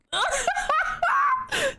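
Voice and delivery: helpless high laugh; high shrieking laugh. Real, not acted. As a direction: burst into squealing laughter. Laughing at own accent.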